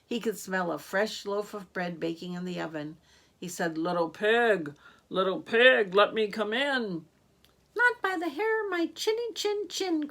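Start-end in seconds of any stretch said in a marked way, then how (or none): 3.8-7.1 wolf voice. Acted. Wolf voiced asking at door. 7.8-10.1 high, tiny pig voice, much higher; squeaky little pig crying out. Acted. Second little pig refusing the wolf, in a high squeaky pig voice. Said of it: "cried the second little pig".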